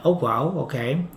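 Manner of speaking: normal talking voice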